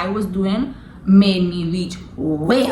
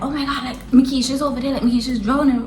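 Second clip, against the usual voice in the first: higher-pitched, mildly alarmed imitation; retold panic; 5 semitones higher, faster than usual. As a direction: re-enact an uncle raising the alarm, lightly. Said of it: "he quickly panicked".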